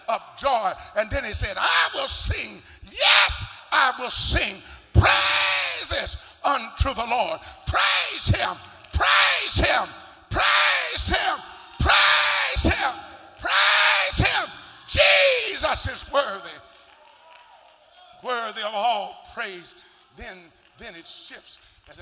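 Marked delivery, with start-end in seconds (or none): 1.6-7.4 much higher and louder, slow, sung-out praise; climactic preaching. Real, not acted. 7.7-14.5 each praise him higher and louder; rising praise shouts. Real, not acted. Repeated rising praise cries. 14.9-19.8 much higher and louder, slow, sung-out praise; climactic preaching. Real, not acted.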